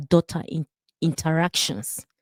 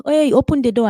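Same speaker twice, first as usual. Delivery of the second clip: raised, higher call through a closed door; louder knocking call, open up; 6 semitones higher, 6 dB louder, faster than usual. Retold or imitated.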